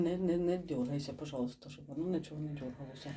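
low, soft, nervous caution; careful, subdued request; hushed plea. Real, not acted. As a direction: keep your voice down and plead for stillness. Nervously asks companion to keep still.

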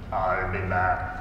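low, slow, heavy Austrian action-hero drawl; Arnold Schwarzenegger impression. Jesus leaves the bar in his Arnold voice. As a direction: deliver it like the Terminator signing off. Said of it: "puts on his best Arnold Schwarzenegger voice".

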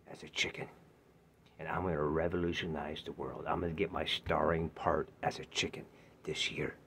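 unvoiced whisper. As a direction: confide it in a hush. Confiding final message.

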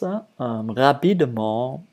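ordinary talk

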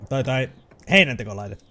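ordinary delivery

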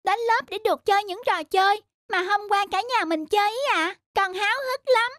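put-on high, eager little boy voice; eager child. Son begging dad to take him to school, high and eager.

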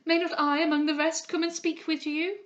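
high, loud eager plea; unrestrained pleading. A narrator's reading. Henrietta begs to speak with the patient, pleading. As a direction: plead urgently, pitched high. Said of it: "who could not now restrain herself".

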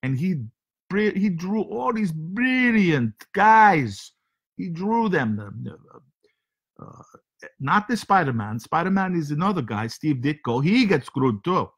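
comic foreign host with broken English; heavy comic accent, broken grammar. Acted. Comic foreign character Sergey hosting show.

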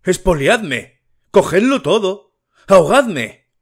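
high, loud furious cry; father's loud outburst at the table; shouted in a changed voice. A narrator's reading. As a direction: yell it as if being robbed blind. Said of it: "gritó con voz alterada".

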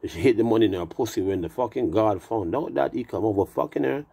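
usual speaking voice

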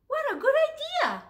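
very high, delighted cry; the animals delighted with the plan; delighted chorus. Acted. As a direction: exclaim happily. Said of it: "the animals said".

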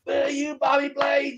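high, drawn-out Boston wrestler shout; Kevin Sullivan. Retold or imitated. Wrestler calling out his opponent after being thrown out, in Boston-guy voice. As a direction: stretch it out in a high Boston heel's voice. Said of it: "he's doing his, his Boston guy".

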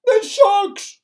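high, loud cry of alarm; sudden high-pitched shout. A narrator's reading. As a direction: cry it out in a panic. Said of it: "cried the earthworm".

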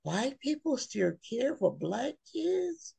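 high, quiet incredulous "Really?"; soft stunned disbelief; inner disbelief. Retold or imitated. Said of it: "And I'm thinking".

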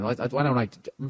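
tripping over his words; stumbling, stuttering restart. Real, not acted. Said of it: "look at me, I'm stuttering here".

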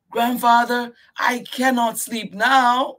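put-on very high, loud child outcry; crying out. Miyuki crying out to grandfather. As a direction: protest loudly. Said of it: "Miyuki cried".

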